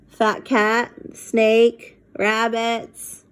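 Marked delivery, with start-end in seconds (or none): none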